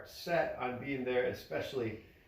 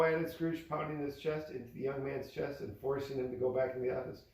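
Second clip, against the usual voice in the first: plain reading voice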